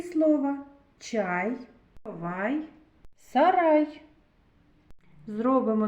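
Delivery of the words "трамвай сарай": ordinary delivery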